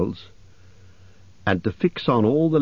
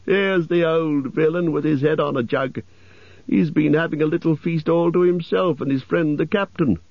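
high, teasing elf voice; high-pitched merry mockery; crowing elf; 7 semitones higher than usual. A narrator's reading. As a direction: announce the find in a high, gleeful call. Elf crows over finding butler.

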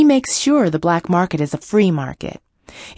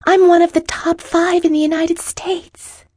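unsteady; 5 dB louder than usual. A narrator's reading. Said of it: "she said unsteadily".

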